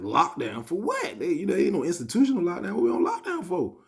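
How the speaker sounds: loud, high, disbelieving protest; indignant protest. Retold or imitated. Inmate objects to being put on lockdown, indignant protest. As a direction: push it up high in mock outrage.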